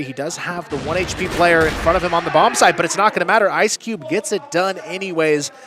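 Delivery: loud excited round call; esports caster. Real, not acted. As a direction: sell the finish with hype. Calling the round win.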